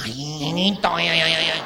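hammed-up porn film moaning; gemidos de actriz. Acts woman moaning in film. (gemidos fingidos de mujer)

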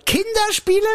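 high, incredulous echo; mock surprise. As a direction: feign shocked disbelief. Feigned mishearing again.

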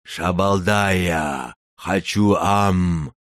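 slow, stretched giant voice; a hungry simple giant calling; dim slow booming giant. Acted. Giant greets the girl.

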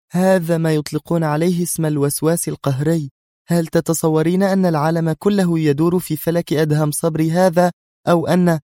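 high-pitched, scoffing argument; disdainful rant. A narrator's reading. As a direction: scoff at her obsession. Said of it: "مطت نادية شفتيها قائلة". (باستخفاف وتهكم)

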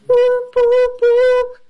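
high siren whoop; alarm whoop. Acted. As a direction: wail it like a computer alarm. Computer alarm noise.

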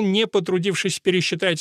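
ordinary delivery

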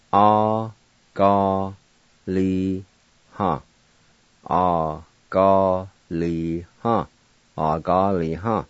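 "Agaliha" as ah-ga-li-ha, each syllable even; Cherokee word agaliha. Shown as a demonstration. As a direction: built up from syllables to the whole word. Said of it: "And now I'll pronounce these words".

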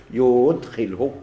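يدخله stretched out slowly; drawn-out, deliberate يدخله; reciting the example. Shown as a demonstration. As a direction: linger on the vowels of يدخله. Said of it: "هذه العبارة هذه ماشي تكون".

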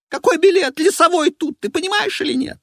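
high, loud and exasperated; a shrieking impatient woman; shrill diva voice. Acted.

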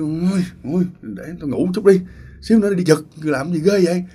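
groggy annoyed grumble. Retold or imitated. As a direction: brush them off, still half asleep.

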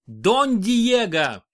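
loud, high, drawn-out rebuke; sharp shout. A narrator's reading. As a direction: shout him down. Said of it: "прикрикнул Хайме".

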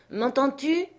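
high, rising called question; calling out. A narrator's reading. Master calls up to Jupiter in the tree, crying out. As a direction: call up to check you are heard. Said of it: "cria-t-il sans m'écouter".

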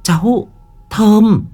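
put-on very high young woman's voice; high-pitched niece insisting; niece's voice. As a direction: call out in short high bursts to convince him. Niece reassures her wailing uncle.

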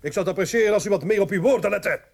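sharp, fast warning, rising to loud; cold rebuke. Acted. Father warns the doctor to mind his words, sharp and fast. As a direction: put the man in his place.

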